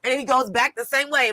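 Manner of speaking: ordinary delivery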